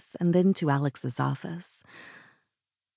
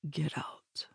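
very soft, whispered order; barely above a whisper; 12 dB quieter than usual. A narrator's reading. Alex orders Sylvia out of his office, barely above a whisper. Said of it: "his voice barely above a whisper".

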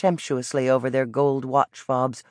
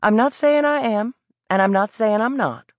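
put-on higher, evasive young boy's voice; defiant Southern boy; 8 semitones higher than usual. A narrator's reading. Boy dodging the vendor, higher and cocky. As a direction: dodge the question with cocky pride.

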